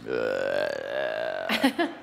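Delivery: low spooky monster laugh; a playful monster chuckle, deep and eerie. Pretend. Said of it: "I want this thing to sort of go like this".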